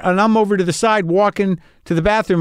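ordinary delivery

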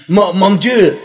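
hammed-up French accent. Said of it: "we also have to do French accents".

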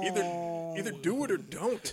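high, pushy and impatient, hammed-up; mock impatient voice. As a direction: snap at him to get it over with. Voicing impatient partner, high.

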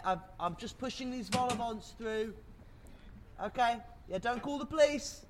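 usual speaking voice

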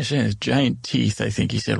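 usual speaking voice

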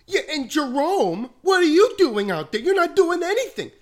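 very high, loud mock scolding; teasing teammates in the coach's voice; Coach Weiss impression. Teen teasing teammates in locker room, high loud mock scold. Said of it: "the Coach Weiss breakdown".

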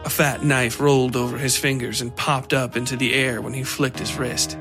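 ordinary delivery

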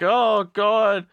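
high, loud cry of dismay; acted horrified exclamation; mock alarm. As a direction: wail it in hammed-up panic. Acting dog attack victim.

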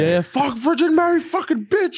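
high, loud mock yell; shouting curse at Virgin Mary; imitating father's screaming. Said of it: "he would scream".

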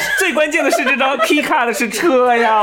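high, loud through laughter; laughing. Real, not acted. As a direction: laugh it out in surprise. Laughing at the surprise card.

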